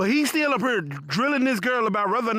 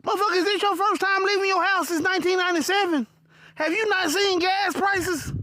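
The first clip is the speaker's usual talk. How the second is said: higher, mocking disbelief; incredulous rant; 8 semitones higher than usual. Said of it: "In my head, I'm like".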